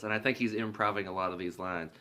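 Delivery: normal talking voice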